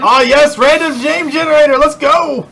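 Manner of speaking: high-pitched mock hype; excited cheer. Real, not acted. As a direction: cheer it like a game-show win. Excited cheer at name generator.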